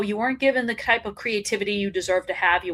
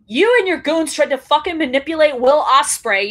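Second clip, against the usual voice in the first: louder, higher angry accusation; fired-up anger; 4 semitones higher, 6 dB louder than usual. Real, not acted. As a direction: call him out with real heat. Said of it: "I'm fired up right now".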